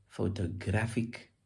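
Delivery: "photographic" with GRAPH stressed; "photographic", slowly, weight on GRAPH; stress on third syllable. Shown as a demonstration. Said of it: "Emphasis shifts".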